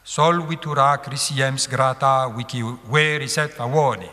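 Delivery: "Sol vitura crisiems" recited evenly with each long syllable leaned on; scanned Latin verse. Shown as a demonstration. Teacher reads Horace's first line for students, metrical recitation. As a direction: read the line in strict metre. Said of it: "Alors ça donne, je recommence".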